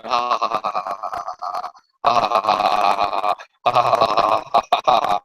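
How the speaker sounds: low, slow mock evil laugh; a hammed-up villain's cackle; lightly voiced evil ha-ha chant; maniacal laugh. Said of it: "laughing maniacally at every page".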